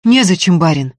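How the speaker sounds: no room for argument; resolute. A narrator's reading. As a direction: answer decisively. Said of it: "решительно сказала Устинья".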